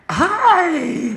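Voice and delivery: very high, loud hi; exuberant greeting. Retold or imitated. Greets a stranger on campus who looks down, high exuberant hi.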